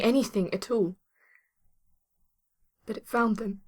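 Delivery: halting recollection with long pauses; grieving. Acted. Survivor telling rescuer how everyone died, halting grief. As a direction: tell it as if close to tears.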